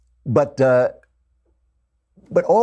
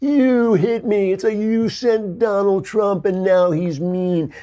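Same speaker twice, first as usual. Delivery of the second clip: much higher, slow, wailing crybaby; a pundit mimics a tattling little brother, slow and much higher; whiny little kid; 11 semitones higher, much slower than usual. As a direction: whine it out like a bratty kid who got hit. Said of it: "the kid's like".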